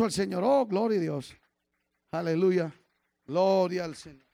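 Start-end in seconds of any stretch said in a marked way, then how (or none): none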